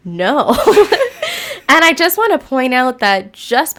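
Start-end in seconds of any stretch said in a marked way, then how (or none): 0.6-1.7 sudden high burst of laughter. Real, not acted. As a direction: laugh out loud in disbelief. Laughing at the call's advice.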